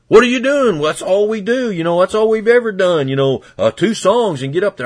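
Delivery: high mock-indignant church member; upset churchgoer. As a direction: squeak it out like a scandalized member. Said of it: "they'd get upset".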